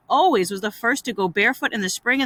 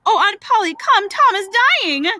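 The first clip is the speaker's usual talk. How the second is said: high, loud cry for help; urgent calling; 10 semitones higher, 5 dB louder than usual. Acted. Brother summoning the aunt to a dying boy, urgent. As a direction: call out in panic.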